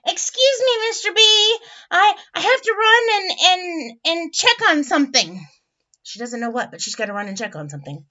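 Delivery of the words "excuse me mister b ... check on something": high, loud excuse-making otter; stammering otter making her getaway; Miss Otter. A narrator's reading. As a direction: fumble for an excuse in a raised voice. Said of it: "She says".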